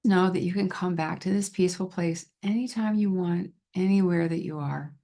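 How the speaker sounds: calm guided relaxation with long pauses between phrases; guided visualization. Shown as a demonstration. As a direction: lead them gently into calm, leaving space to breathe.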